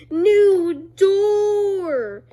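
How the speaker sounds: loud, high gleeful announcement; excited shout. Retold or imitated. Scene: boy finds a new door in the game, excited loud callout. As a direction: call it like a game discovery. Character calls out game item.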